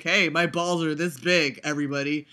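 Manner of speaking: normal talking voice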